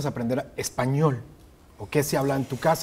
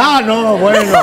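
loud, higher mock disappointment; a hammed-up loud sigh of defeat; mock letdown; 7 semitones higher, 12 dB louder, slower than usual. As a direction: play it up as a letdown.

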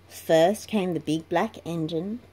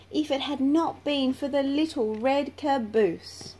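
grateful engines; 6 semitones higher than usual. A narrator's reading.

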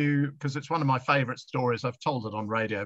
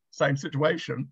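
ordinary talk

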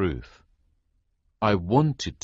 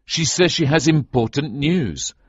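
louder, higher pleading argument; arguing; 8 semitones higher, 5 dB louder than usual. A narrator's reading. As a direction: keep pressing the point. King arguing with colonel.

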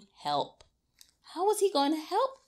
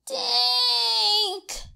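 high cry of the name; higher calling out; cried; 11 semitones higher than usual. A narrator's reading. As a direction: call out his brother's name. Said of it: "cried Fergus".

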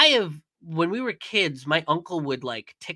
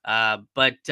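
usual speaking voice

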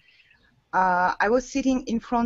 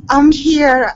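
booming; 4 semitones higher, 10 dB louder than usual. Real, not acted. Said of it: "you sounded like a superhero kind of thing".

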